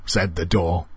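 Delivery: usual speaking voice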